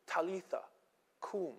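soft, gentle call with space between the words; tender, precious voice. Acted. Jesus waking a little girl in her room, very tenderly. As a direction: softly rouse a beloved child. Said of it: "it's the most tender and precious voice".

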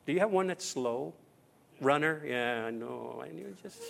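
ordinary talk

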